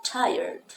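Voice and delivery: Tired said high with the ending turned to er; showing the flipped letters; tired as tajer. Shown as a demonstration. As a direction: finish Tired with er. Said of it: "R E vira er".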